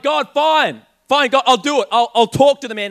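giving in to God. Retold or imitated. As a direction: throw up your hands and shout it out. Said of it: "I'm like".

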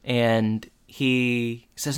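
ordinary talk, nothing marked

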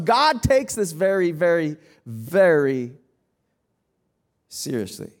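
very, very seriously drawn out slow, dropping low; slow down and sink on "very, very seriously"; slow emphatic. Real, not acted.

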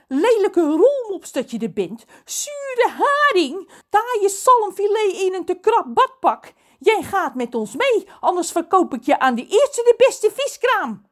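high, loud tirade of insults; furious. A narrator's reading. John threatens Lorelei, shouting in fury. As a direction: hurl each insult at full pitch. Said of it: "stottert hij woedend".

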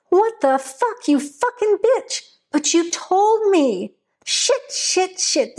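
high, furious cursing with the voice leaping up; a man losing it, cursing high and hard; furious outburst. Acted. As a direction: explode at her in disbelief, pitch soaring. Greg slams the wall, curses Hunter.

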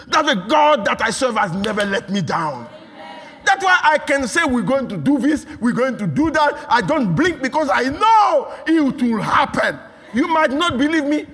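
loud, high, fervent preaching; preaching at full force. Acted. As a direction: hammer it home to the congregation.

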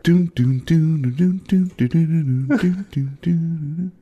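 high, rhythmic "doon" hums; distant music beat. Acted. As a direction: hum a thumping beat far off. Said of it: "in the distance you hear".

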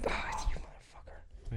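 hushed unvoiced whisper. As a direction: whisper it under your breath. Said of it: "all he goes".

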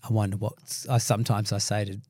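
ordinary talk, nothing marked

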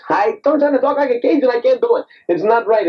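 high-pitched exasperated outburst. Real, not acted. Actor failing at his Cajun accent, exasperated. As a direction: throw it up high in frustration.

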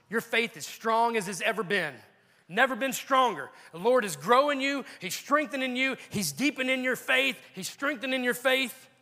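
high, building preacher's cadence; fervent preaching. Real, not acted. Preacher affirming believers growing strong in faith, fervent. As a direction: pile up each phrase with fervor.

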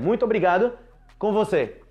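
"Muito obrigado com você" said high and loud as a mocked error; mock wrong phrase. Shown as a demonstration. Teacher mocks a learner's error, high and loud. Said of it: "Hã?".

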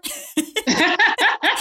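real laugh breaking out, high; amused laugh. Real, not acted. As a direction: laugh at the guest's mischief. Host laughs at guest's story.

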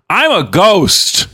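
put-on slow high little-kid voice; slow toddler voice. Quoting toddler son.

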